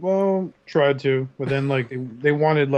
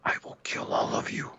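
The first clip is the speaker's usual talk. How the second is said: whispering; 7 dB quieter than usual. Pretend. Mock threat whispered.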